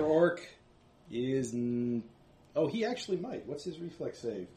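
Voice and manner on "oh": usual speaking voice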